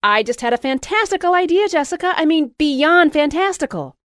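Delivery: high and loud thrilled announcement. A narrator's reading. Louise announcing her big idea, high and loud. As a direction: bubble over with the idea.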